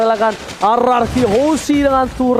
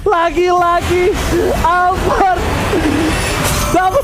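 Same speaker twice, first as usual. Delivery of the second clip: hyped call; 6 semitones higher, much slower than usual. Real, not acted. Hype after the savage.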